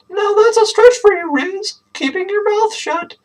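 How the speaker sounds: high teasing heckle; mock her sister from up high; heckling. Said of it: "Deb heckled".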